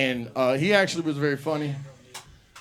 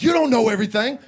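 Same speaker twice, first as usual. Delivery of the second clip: indignant retort; 6 semitones higher, 7 dB louder, faster than usual. Retold or imitated. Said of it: "He's like".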